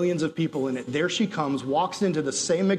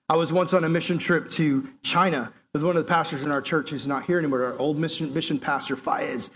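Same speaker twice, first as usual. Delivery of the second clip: normal talking voice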